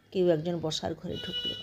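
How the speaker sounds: ordinary delivery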